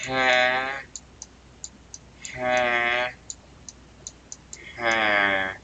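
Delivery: curious little cartoon huh's; puzzled huh. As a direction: ask it over and over, confused. Character puzzled, repeated.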